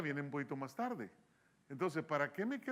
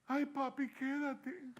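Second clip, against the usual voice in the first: pleading wife; 8 semitones higher, slower than usual. Retold or imitated. Wife voiced pleading him stay.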